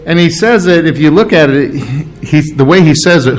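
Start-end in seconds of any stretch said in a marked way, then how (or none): none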